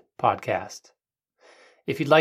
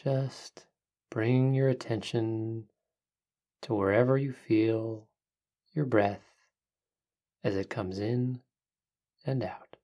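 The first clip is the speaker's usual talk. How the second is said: soft spa whisper; 5 dB quieter than usual. Acted. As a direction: hush it down, calm and unhurried. Leads a guided breathing meditation.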